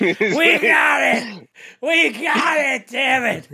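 excited yelling; triumphant shout. Retold or imitated. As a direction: shout it like you just won. Host acts out Joe's triumphant shout.

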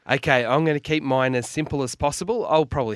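bursting in loudly; loud and excited. Real, not acted. Announces his bets loudly.

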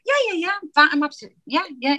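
high-pitched, over-eager reassurance; brave front. Retold or imitated. Nervous performer reassuring a friend in the car, high brave front. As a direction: insist you're fine in a high, hurried voice. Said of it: "it was absolutely all a front".

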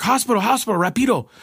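urgent plea. Retold or imitated. Friend urging driver to hurry.

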